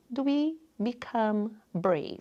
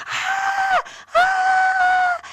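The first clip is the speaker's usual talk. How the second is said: high mock scream of a hurt little girl; imitating a scared girl's high yelp; child's scream; 6 dB louder than usual. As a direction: shriek like a frightened child. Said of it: "and it was screaming".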